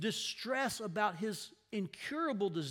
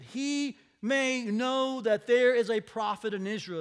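proclaiming; 3 semitones higher, 8 dB louder than usual. Acted. Prophet's declaration, quoted.

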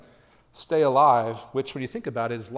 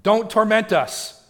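loud, high, slow desperate plea; begging demon; 8 semitones higher, 9 dB louder, slower than usual. Acted. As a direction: stretch out a frantic begging cry. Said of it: "So they're begging".

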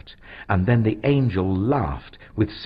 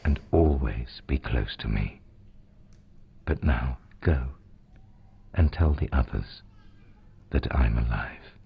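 gently; 9 semitones lower than usual. Acted. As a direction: tenderly send her off. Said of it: "Jesus said gently".

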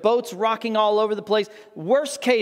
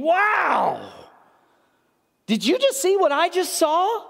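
high-pitched, awestruck wonder; acted amazement; 9 semitones higher than usual. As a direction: play a disciple who can't believe it. Disciples amazed after witnessing miracle.

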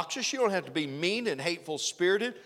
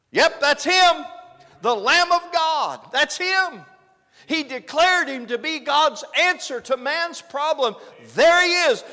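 high, very loud cries; excited shouted announcement; 10 semitones higher, 9 dB louder than usual. Acted. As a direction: shout it out to a crowd of onlookers. Acts John pointing out the Lamb.

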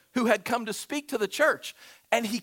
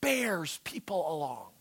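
whispered; 4 semitones lower, 5 dB quieter than usual. Real, not acted. Sermon, whispered close of point.

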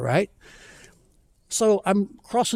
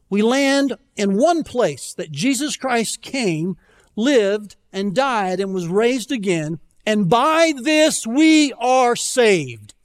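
building preaching; 3 semitones higher, slower than usual. Real, not acted. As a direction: preach it up to a strong finish. Climax of the gospel statement.

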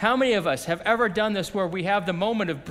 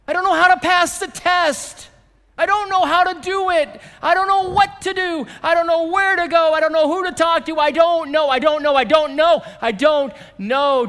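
loud, high, rapid run of I don't know lines; excited shouted excuses; 9 semitones higher, 9 dB louder than usual. Acted. Preacher piling up the congregation's excuses, shouted fast. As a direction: hammer each excuse out louder and faster.

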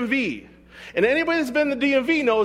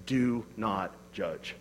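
solemn quote; 11 semitones lower, 10 dB quieter, much slower than usual. Acted. Quoting Jesus' command.

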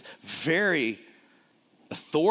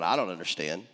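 confused listener; 7 semitones lower, faster than usual. Retold or imitated. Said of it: "Some of you are going".

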